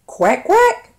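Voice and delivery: high quacking duck. Pretend. Duck sound for kids.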